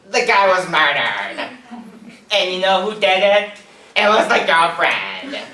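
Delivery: slow, loud, drawn-out gossip telling a murder story; casual gossipy reporter. Retold or imitated. Comedian imagining a female reporter gossiping about a murder, slow and loud. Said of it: "a female reporter going".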